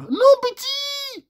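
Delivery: exaggerated high whine of disbelief; stretched high protest. Real, not acted. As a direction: wail the protest slowly and high. Protesting the ad's claim.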